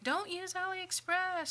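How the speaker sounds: hammed-up chorus of warnings. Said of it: "And then everybody's like".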